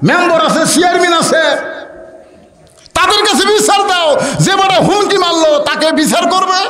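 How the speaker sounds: very high, shouted indignant urging; rally him like an outraged wife; acted high-pitched tirade. Wife's indignant outburst acted.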